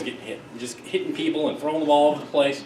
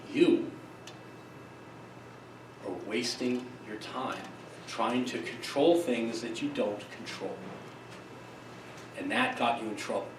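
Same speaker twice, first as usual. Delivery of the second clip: calm pitching coach; 4 semitones lower, 12 dB quieter than usual. Retold or imitated. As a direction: imitate a coach quietly explaining a hard truth. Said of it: "puts her arm around her".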